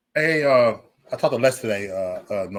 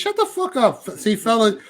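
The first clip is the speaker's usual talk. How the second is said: mock angry yell; 7 semitones higher, 4 dB louder than usual. Real, not acted. Yelling at arguing chat member.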